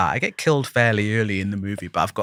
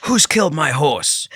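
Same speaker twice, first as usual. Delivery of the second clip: slow, higher delivery of a script line; measured mock shout, a little raised; mildly raised shout; 5 semitones higher, slower than usual. Shown as a demonstration. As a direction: call it out slowly, only half shouting. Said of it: "shout and go".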